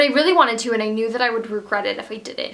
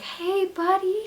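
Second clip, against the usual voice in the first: tender greeting; 8 semitones higher, 5 dB quieter, slower than usual. Retold or imitated. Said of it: "I just said".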